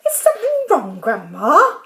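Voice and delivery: politely innocent question, starting high. A narrator's reading. George to Grandma stuck in midair, polite. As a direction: ask it high and politely, lifting at the end. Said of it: "George asked her politely".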